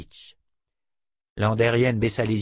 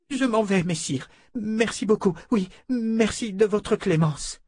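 higher, pleading noblewoman voice; distraught noblewoman; 12 semitones higher than usual. A narrator's reading. Claudine pleading with Fitch, a lightly voiced distressed lady. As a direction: beg not to be sent back.

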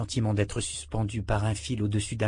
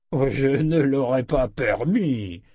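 put-on slightly slow, gently protesting old wizard; mild protest; 4 semitones higher, 5 dB louder, slower than usual. A narrator's reading. Old wizard protests he'd never let Richard starve. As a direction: brush off the idea with affection. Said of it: "protesta Z".

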